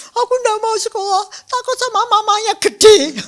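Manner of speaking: put-on high, loud child's voice; scared child Putu. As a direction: protest as a frightened little kid. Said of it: "Putunya juga gitu". (suara anak kecil menolak, nyaring)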